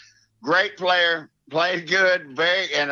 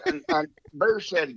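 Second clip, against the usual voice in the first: ordinary delivery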